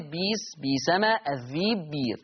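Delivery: each of "بيس، بيسمة، الذيب، بير" with a long ee and no glottal stop; hamza becomes yaa. Shown as a demonstration. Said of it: "هكذا".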